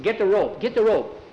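urgent call for the rope. Acted. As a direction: rush them for the rope. Urgent order in crisis.

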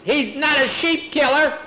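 put-on high, loud boyish cry; outraged protest. Boy defending his dog, loud high protest.

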